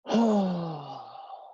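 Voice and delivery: long moaning sulky sigh; hammed-up sulky sigh; dramatic sigh. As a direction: sigh like you were ignored. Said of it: "this is what they could sometimes do".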